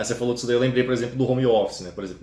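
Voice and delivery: ordinary talk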